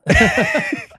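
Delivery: loud, high laugh breaking out; laughing hard at the clueless fans; high burst of laughter. Real, not acted. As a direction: crack up at your own story. Laughs at his own story.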